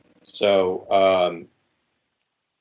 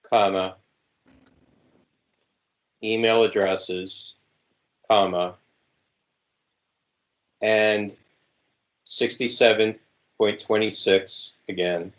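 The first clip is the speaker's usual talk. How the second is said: dictating. Shown as a demonstration. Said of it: "Okay, I am ready again".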